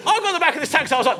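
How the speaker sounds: usual speaking voice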